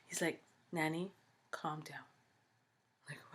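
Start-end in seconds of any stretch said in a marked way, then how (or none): 0.7-2.0 hushed mock calming voice; soft retold reassurance, almost whispered; imitating soothing child. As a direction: quietly play a child calming a parent. Son soothing his worried mother.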